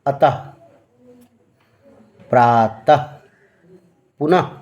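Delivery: अतः प्रातः पुनः each ending in a clear breathy ह; visarga example words. Shown as a demonstration. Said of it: "जैसे".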